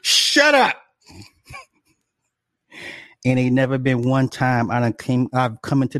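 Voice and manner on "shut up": shut up. Real, not acted. Laughing reaction to own story.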